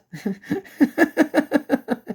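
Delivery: a long high real laugh; high giddy laugh. Real, not acted. Thanking God for the footage, a high, giddy real laugh. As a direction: let the laugh spill out.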